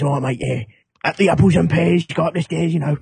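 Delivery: broad mock Cockney accent; East London Cockney. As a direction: lay on the East End rhyming-slang patter. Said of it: "a bird in the east of London".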